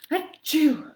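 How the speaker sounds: high, loud hammed-up achoo; cartoon sneeze; big fake sneeze. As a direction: throw a huge theatrical sneeze. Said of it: "a pretty dramatic reading".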